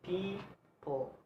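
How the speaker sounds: "people" softly as PEE, pause, pul; soft syllable-by-syllable "people"; split into syllables. Shown as a demonstration. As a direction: break "people" in two with a gap. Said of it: "two syllables".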